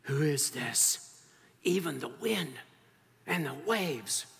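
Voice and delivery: slow, spaced-out amazement; awed wonder. Acted. Disciples asking each other about Jesus, slow awe. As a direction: marvel slowly, phrase by phrase. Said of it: "a jumbled mix of, of amazement and wonder and awe".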